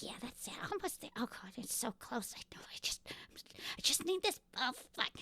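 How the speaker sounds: hushed, intent self-talk, partly whispered; muttering to herself. Acted. Woman welding alone in the steeple, muttering to herself. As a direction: mutter under your breath while absorbed in fiddly work. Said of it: "constantly talking to herself".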